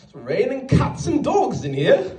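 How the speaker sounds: high, slow mock British accent; hammed-up British weather remark; British coworker. British coworker bringing out rain metaphors, hammed-up British accent. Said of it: "waiting for the rain metaphors to come out".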